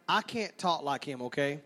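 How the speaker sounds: normal talking voice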